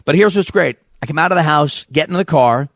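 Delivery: normal talking voice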